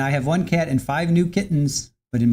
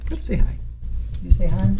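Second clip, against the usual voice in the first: high cooing pet voice; sing-song call to a kitty; 5 semitones higher than usual. Real, not acted. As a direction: coax the cat sweetly, high up. Coaxing cat to greet.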